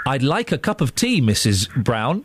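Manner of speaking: low, measured southern English prompt; put-on southern English, dropping low; southern English accent. Shown as a demonstration. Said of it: "do a s-, a southern accent".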